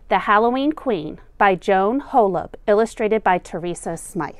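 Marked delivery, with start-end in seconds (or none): none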